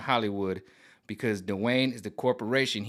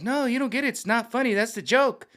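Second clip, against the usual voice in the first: higher mock woman's voice; lightly voiced mock host, higher; 11 semitones higher, 3 dB louder than usual. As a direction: imitate the radio host explaining. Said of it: "she's like".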